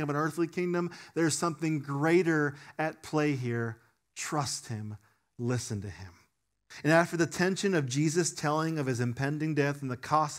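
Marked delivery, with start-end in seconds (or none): 4.1-6.1 low, soft, slow plea; hushed appeal. Real, not acted. Pastor quietly urges the congregation to trust Jesus. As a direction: lean in and quietly urge.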